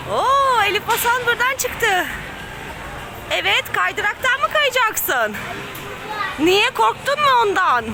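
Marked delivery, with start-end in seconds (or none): none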